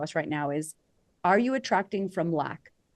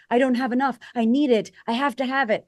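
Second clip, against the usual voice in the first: higher, louder mock grasping; desperate need; 5 semitones higher, 5 dB louder than usual. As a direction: plead like you can't live without it. Acting scarcity mindset.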